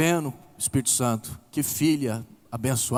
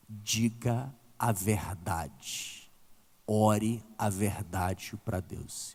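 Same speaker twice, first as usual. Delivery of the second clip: softer, lower, very slow coaxing; slow soft guidance; 7 semitones lower, 8 dB quieter, much slower than usual. Retold or imitated. Pastor softly urging the grieving woman to pray honestly, very slow and soft. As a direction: gently draw the truth out of her, slowly. Pastor guiding her slowly.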